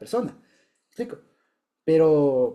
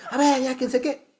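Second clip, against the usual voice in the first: imitated flare-up, pitched high; mock confrontational outburst, high-pitched; hot-headed; 9 semitones higher than usual. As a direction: act out losing your cool. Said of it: "en ese momento caliente a decir".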